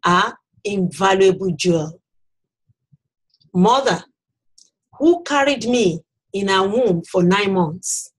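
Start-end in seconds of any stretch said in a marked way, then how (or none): none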